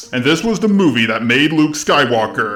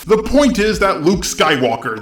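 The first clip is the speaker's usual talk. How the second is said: ordinary talk, nothing marked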